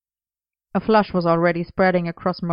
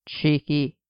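growled; 3 semitones lower than usual. A narrator's reading. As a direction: growl it, teasing. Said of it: "Arthur growled".

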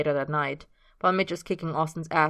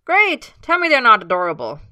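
gleeful; 7 semitones higher, 12 dB louder than usual. Acted. Mitch gleeful.

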